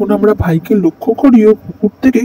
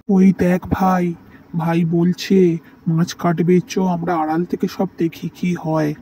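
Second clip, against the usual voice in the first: hushed plotting; 3 semitones lower, 7 dB quieter than usual. Acted. Brothers spying from hiding.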